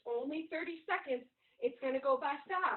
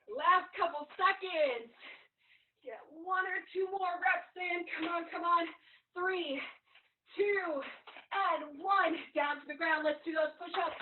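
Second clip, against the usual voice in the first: pumped-up coaching; 4 semitones higher, 5 dB louder than usual. Real, not acted. Trainer pushing through last reps.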